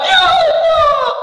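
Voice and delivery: high, drawn-out wailing plea; long high-pitched call to God; voiced supplication. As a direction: imitate someone crying out in prayer. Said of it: "istighfar dengan suara".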